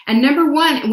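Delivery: ordinary delivery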